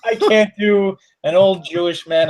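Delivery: laughing through the words; giggling apology to the co-host about a failed accent joke, laughing. Real, not acted. Laughing while apologizing to the co-host.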